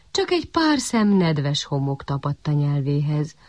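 plain reading voice

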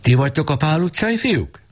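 high, stern call from the doorway; stern man calling in. A narrator's reading. The bearded father calling to the boys from the gate. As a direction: call in like a strict gentleman. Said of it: "bekiáltott".

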